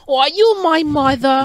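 a tiny cartoon child asking.

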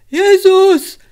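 high, loud shout of shock; full-voiced, high exclamation of pain; sudden cry. A narrator's reading. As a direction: cry out as if struck down. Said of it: "krzyknął i cisza".

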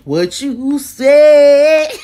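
very slow, loud stretched question; hammed-up slow challenge; drawn-out sassy. Real, not acted. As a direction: drag every word out, teasing. Repeated teasing question.

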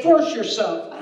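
normal talking voice

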